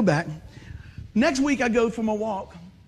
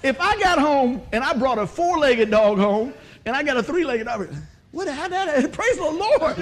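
ordinary delivery

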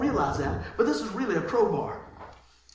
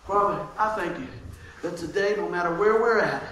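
prayer; 4 semitones lower than usual. Acted. Pastor praying over the congregation, hushed and earnest.

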